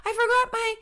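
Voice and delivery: put-on high, loud whiny kid voice; son asking mom. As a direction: play the forgetful boy pleading with mom. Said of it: "he'd be like".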